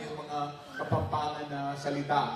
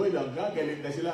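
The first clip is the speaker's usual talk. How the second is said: ordinary talk, nothing marked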